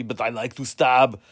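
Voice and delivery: loud, slow, put-on cellmate voice; prison roommate voice. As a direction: play a menacing cellmate for laughs, loud and drawn out. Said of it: "my roommate would be".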